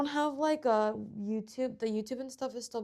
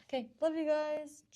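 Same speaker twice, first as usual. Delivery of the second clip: ordinary talk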